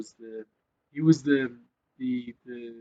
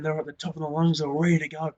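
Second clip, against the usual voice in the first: ordinary talk, nothing marked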